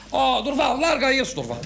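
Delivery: imitated exasperated call; urgent pleading. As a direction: plead with him to let go. Boss pleading with man to let go.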